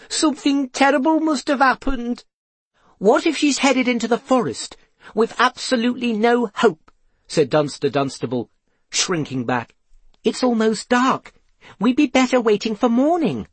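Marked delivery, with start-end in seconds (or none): 0.1-2.2 very high, distraught wail. A narrator's reading. Mother fears for missing daughter, wailing. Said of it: "wailed Norrie". 3.0-6.9 high, fretful character voice; fearful. A narrator's reading. Dunster dreads searching the forest, fearful. 10.2-13.5 high, anxious character voice; timid. A narrator's reading. Dunster wants to wait for morning, timid. Dunster shrinking back from search.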